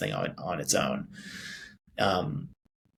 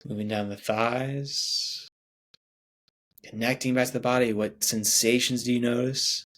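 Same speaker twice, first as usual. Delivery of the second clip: guided meditation. Acted. Meditation guide leading listeners through breathing, soothing. Leads a guided body scan.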